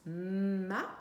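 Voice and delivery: "ma" with a held m and rising a; syllable sounded out. Shown as a demonstration. Teacher recalling which sounds make the syllable, sounded out. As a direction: stretch the m of "ma", then lift the vowel. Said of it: "Là, en l'occurrence".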